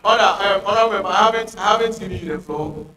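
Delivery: loud, firm call to order. Real, not acted. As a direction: raise the voice to stop a speaker. Chair calling a member to order.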